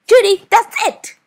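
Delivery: angry teacher. Acted. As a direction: she has had enough, shout it. Teacher loses patience with Judy.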